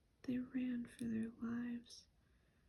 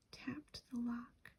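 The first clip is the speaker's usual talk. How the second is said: ordinary delivery, nothing marked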